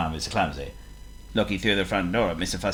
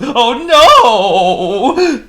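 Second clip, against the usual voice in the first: much higher, much louder wail of despair; horrified cry; 12 dB louder than usual. Acted. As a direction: cry out in comic horror. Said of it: "he gasped".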